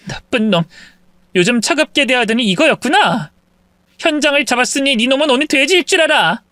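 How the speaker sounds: scornful wife catching her cheating husband; furious wife confronting husband. Acted. Wife catching husband at mistress's house, scornful and angry. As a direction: spit out the accusation at him.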